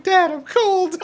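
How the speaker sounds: put-on high, laughing little-kid whine; retelling the son's complaint in a high child voice; imitating toddler. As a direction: play the small son, half laughing. Imitating three-year-old son.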